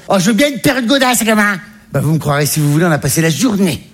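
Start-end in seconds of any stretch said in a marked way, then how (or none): 0.1-1.6 high-pitched mum accepting a gift; imitated mother. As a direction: answer in the higher mother voice. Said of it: "qu'est-ce qui te ferait plaisir?". (voix haute de la mère imitée)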